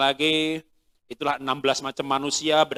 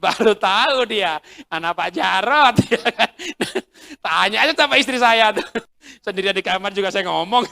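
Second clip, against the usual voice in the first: laughing loudly; 6 semitones higher, 8 dB louder than usual. Real, not acted. Speaker laughing loudly as he admits to the audience that he talks to himself. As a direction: confess it through laughter.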